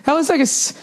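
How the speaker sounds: high, dreamy mock-woman voice; high-pitched ditzy mock rapture; airy spiritual lady. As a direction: gush like it was a spiritual awakening. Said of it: "this woman gets up like".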